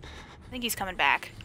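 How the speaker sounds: low, soft wary murmur; hushed wariness. Real, not acted. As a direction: keep it down, warily. Watching for the monster returning.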